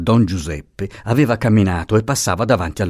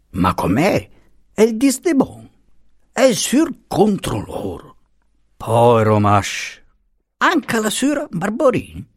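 put-on surprised old lady; 10 semitones higher than usual. Peppina's startled dialect remarks.

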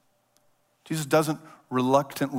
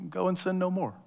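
tender forgiveness; 5 semitones higher, 7 dB quieter than usual. Retold or imitated. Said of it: "And Jesus says".

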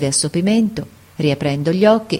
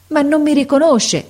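higher, louder cry of despair; a boy's anguished outcry; desolate exclamation; 9 semitones higher, 3 dB louder than usual. A narrator's reading. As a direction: burst out in desolation. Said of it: "esclamò il ragazzo in tono desolato".

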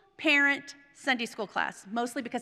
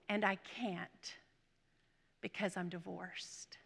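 low, slow, fading confession; retold dejection; 4 semitones lower, 10 dB quieter, slower than usual. Crying woman confesses to her pastor at the rail, quiet dejection. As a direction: let it sink to a hushed admission.

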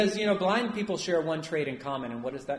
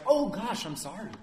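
apologetic; 6 semitones higher, 4 dB louder than usual. Acted. A person who gives in when shushed, apologetic. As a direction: play someone meekly backing down. Imagined meek apology from Bartimaeus.

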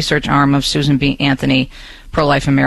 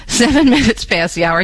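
time check with a real laugh and a higher pitch; 6 semitones higher than usual. Real, not acted. Host laughing at his computer while giving the time. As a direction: chuckle through it, relieved the news finally loaded.

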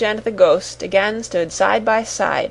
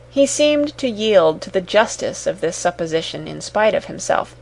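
ordinary delivery, nothing marked

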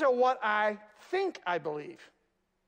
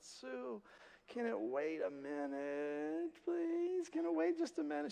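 hushed plea; 5 semitones higher, 10 dB quieter, slower than usual. Retold or imitated. Pastor pleading with Sue aside.

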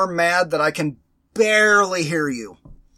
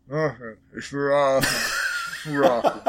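faint distant voice; 6 semitones lower, 4 dB quieter than usual. Pretend. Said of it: "somewhere in the distance, somebody's gonna hear".

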